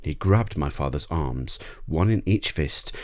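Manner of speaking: ordinary delivery, nothing marked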